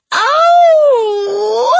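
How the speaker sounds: high loud musical wolf howl. Acted. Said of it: "she howled, quite musically".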